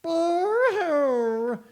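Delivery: high loud mock wail; boo-hoo like a baby; baby crying. Baby joining in, mock crying. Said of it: "he fetched a baby".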